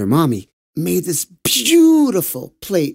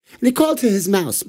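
plain reading voice

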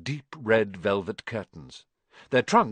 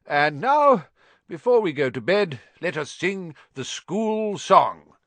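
put-on higher, louder jolly old headmaster; Dumbledore crying out; 5 semitones higher, 4 dB louder than usual. A narrator's reading. Headmaster rallying the students before bed, cheerful call. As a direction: call the hall to sing like a merry old wizard. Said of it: "cried Dumbledore".

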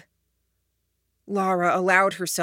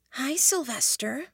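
breathy whisper; 7 semitones higher, 5 dB quieter than usual. A narrator's reading. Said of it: "her whisper very breathy".

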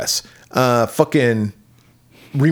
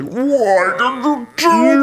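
high, drawn-out monster wail; gargoyle transforming; 12 semitones higher, 3 dB louder, much slower than usual. Acted. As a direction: stretch every word into a monstrous howl. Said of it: "She's the gargoyle".

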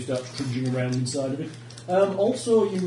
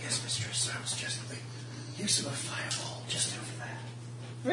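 whispered dragon servant; 7 semitones higher, 9 dB quieter than usual. Acted.

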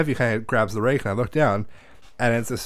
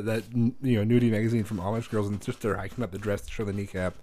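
normal talking voice

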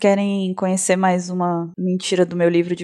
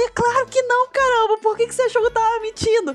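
much higher, hammed-up offended protest; mock indignant; 13 semitones higher than usual. [name] to her friend, pretending to be offended, much higher. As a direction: play the innocent victim, overdone. [name] plays the wronged victim.

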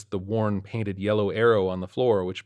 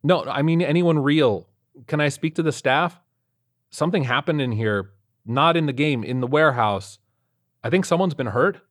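loud, high, urgent pleading; urgent calling out; 6 semitones higher, 6 dB louder than usual. Acted. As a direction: call out urgently for help. Urgent plea to unseen staff.

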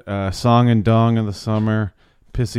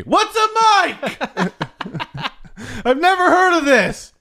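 Billy Mays voice. Acted. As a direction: shout it like an infomercial host.

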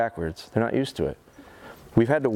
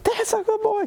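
high excited praise. Real, not acted. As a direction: gush over the dog like he won a prize. Celebrating dog's success.

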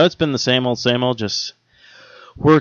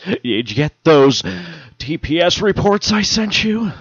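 slow, droning boss voice, pitched up; boss impression; 6 semitones higher, slower than usual. Office Space parody, nagging boss asking for reports. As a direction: drag it out like a smarmy office boss.

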